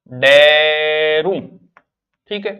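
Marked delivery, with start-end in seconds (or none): 0.1-1.4 डेरू stretched very slowly with a rise; drawn-out demo. Shown as a demonstration. Teacher showing students the word डेरू, drawn out slowly. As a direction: drag out डेरू slowly, louder.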